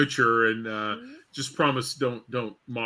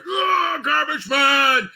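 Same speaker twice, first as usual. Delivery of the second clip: high, slow, loud wrestler bellow; in character shout; 6 dB louder, much slower than usual. Retold or imitated. Wrestler jumping into character for a fan, slow loud shout. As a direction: bellow the ring name like a showman. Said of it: "I jump right into character".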